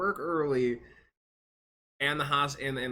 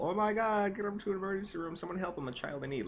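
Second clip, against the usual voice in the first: high, rushed mock alarm; mock panic; 9 semitones higher than usual. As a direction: imitate an overdramatic rescuer, pitched way up. Imagined emergency panic, mocked.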